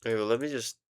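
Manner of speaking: ordinary talk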